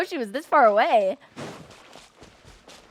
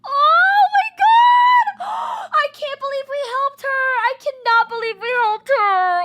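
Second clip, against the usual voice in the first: shrieking jackpot winner; 9 semitones higher, 6 dB louder than usual. Real, not acted. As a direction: scream it with pure joy. Overjoyed after helping her.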